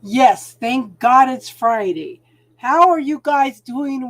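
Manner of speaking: loud, joyful cheer; excited. Real, not acted. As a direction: celebrate the end of the week out loud. Cheering for the weekend.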